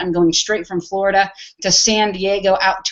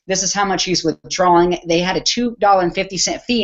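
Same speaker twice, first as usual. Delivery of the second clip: usual speaking voice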